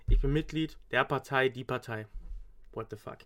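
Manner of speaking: normal talking voice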